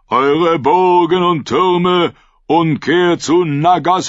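rally all captains with fervour; rousing sermon. Acted. Prophet's rousing call. (Prophet ruft laut alle Kapitäne zur Versammlung)

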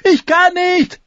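high, loud, helpless wheeze; gasping with laughter. A narrator's reading. Tyrion unable to stop laughing before his sister, gasping. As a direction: choke the words out through laughter. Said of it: "keuchte er".

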